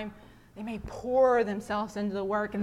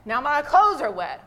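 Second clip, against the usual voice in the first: rising to a loud angry whine; upset complaint; 9 dB louder than usual. Retold or imitated. Nigel complains to the pastor, rising upset. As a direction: vent that your clothes got wet. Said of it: "Nigel answers angrily".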